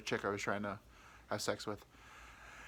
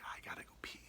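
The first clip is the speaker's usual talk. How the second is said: hushed whisper; whispered excuse; 15 dB quieter, faster than usual. Retold or imitated. Slipping away from the table, whispering an excuse. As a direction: whisper your getaway.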